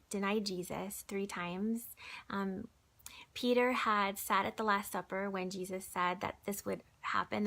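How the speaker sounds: ordinary delivery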